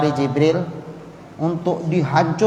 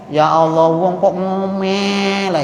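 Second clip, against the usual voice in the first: slow, sung-out grumble; mocking singsong; much slower than usual. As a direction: tease the nagging in a lilting moan. Husband mocking wife's nagging. (suami mengeluh sambil berlagu, mengejek omelan istri)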